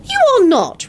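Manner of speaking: protest, shocked and appalled; scandalized. A narrator's reading. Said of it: "Rosie protested in a scandalized tone".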